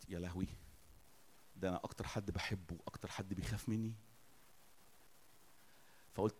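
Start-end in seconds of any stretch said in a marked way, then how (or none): 0.1-3.9 soft, low retold inner thought; hushed realisation. Father realises his beloved child fears him, hushed. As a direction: quietly let the painful discovery sink in.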